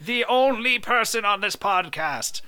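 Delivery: high, loud, drawn-out announcement; slow, hammed-up booming declaration; mock grand proclamation. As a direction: proclaim it like a cartoon overlord.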